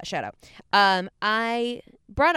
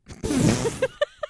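breaking into giggles; laughter escapes; burst of high laughter; 14 semitones higher than usual. Real, not acted. Laugh bursts out after word.

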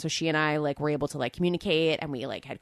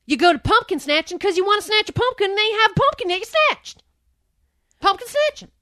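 very high, loud hillbilly voice; mock Southern local defending pumpkin snatching; 10 dB louder than usual. S0 riffs in the Southern voice.

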